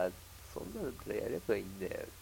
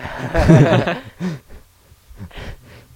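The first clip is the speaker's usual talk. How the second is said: big loud laugh bursting out; loud burst of laughter; 7 semitones higher, 19 dB louder than usual. Real, not acted. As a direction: let out a loud real laugh. Laughs at whining cohost.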